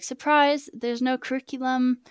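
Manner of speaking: loud, sarcastic game-show surprise; mock cheerful reveal. To viewers, sarcastically announcing the language is dying, mock cheerful. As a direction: sell the bad news like a cheery prize. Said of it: "it was like".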